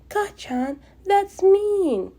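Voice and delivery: gasp, then scold him shrilly high; shocked scolding. Acted. Izuku scolding after a gasp.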